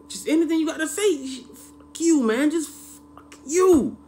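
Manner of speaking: high, loud, drawn-out fuck you; a hammed-up angry outburst; furious yell. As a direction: stretch out the last curse slowly. Ranting at the character.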